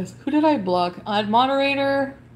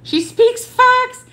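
much higher, louder and slow, drawn-out sarcasm; sarcastic mock cry; 13 semitones higher, 7 dB louder, much slower than usual. Real, not acted. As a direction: sing it out in mock agreement, dripping sarcasm. Sarcastic jab at a chatter.

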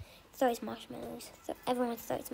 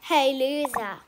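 taunt; 8 dB louder than usual. Acted. Bully taunting in play.

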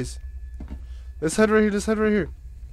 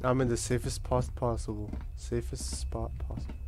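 whispering; 8 semitones lower, 13 dB quieter than usual. Real, not acted. Hiding from monster, whispers.

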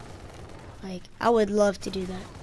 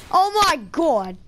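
usual speaking voice

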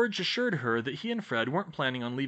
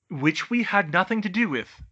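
hasty, higher disclaimer; said quickly; 6 semitones higher, 7 dB louder than usual. A narrator's reading. Fred disowns Hagrid's deeds to Bane, hastily. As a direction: jump in loudly to deny blame. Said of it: "Fred said quickly".

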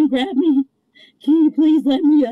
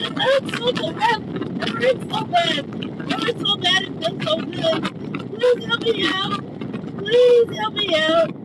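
high, softer whining about the pain; whimpering plea; 7 semitones higher, 3 dB quieter than usual. Real, not acted. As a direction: plead softly and high, as if hurting. Pleading in pain, back seat.

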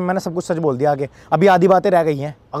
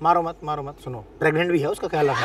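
low, hushed placating; soft pleading restraint; 4 semitones lower, 7 dB quieter than usual. Acted. Boyfriend asking father not to hit pregnant girlfriend, hushed pleading. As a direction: talk them down softly. Talking father out of hitting her.

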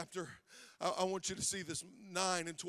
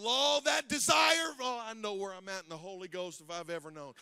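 full-force preaching; 4 semitones higher, 13 dB louder than usual. Acted.

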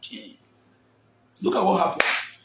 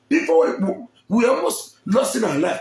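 usual speaking voice

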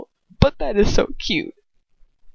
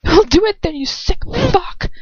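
screaming defiance; 10 semitones higher than usual. Acted. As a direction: scream it back at him. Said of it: "I scream".

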